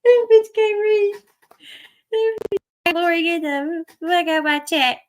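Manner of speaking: loud, high, laughing yell; hooting with laughter at the streamer; laughing shout. Real, not acted. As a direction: crack up and shout it out.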